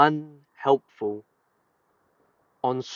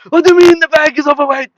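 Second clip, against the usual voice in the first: high, loud, fast mock rant; mock furious passenger; 13 dB louder, faster than usual. Frantic passenger yelling at the desk about an overweight bag. Angry passenger quoted in story.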